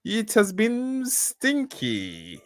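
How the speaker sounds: high, theatrical monk voice; monk character. Acted. Monk welcomes two smelly travellers, high put-on voice. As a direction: play the monk high, stretching out the last words.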